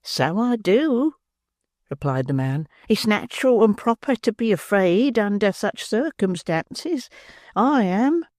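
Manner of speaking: slow, high, frank admission; philosophical calm. Acted. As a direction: admit fear calmly. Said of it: "most philosophically".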